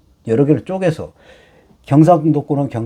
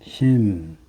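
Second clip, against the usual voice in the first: slow reading; 3 dB quieter, much slower than usual. Shown as a demonstration. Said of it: "지금 요거는 마음 심자예요".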